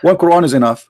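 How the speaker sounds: rapid, disbelieving challenge; fast incredulous. Real, not acted. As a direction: rush it out in disbelief. Accusing him of contradicting himself.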